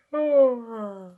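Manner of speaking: long acted yawn. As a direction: let out a yawn.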